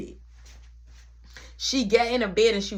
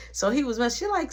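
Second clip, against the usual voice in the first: ordinary delivery, nothing marked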